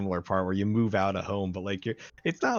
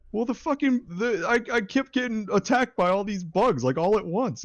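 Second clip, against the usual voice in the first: much higher, whiny mock dad; mimicking dad's excuse; 11 semitones higher, 3 dB louder than usual. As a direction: plead like a frustrated gamer dad. Dad voiced defending himself.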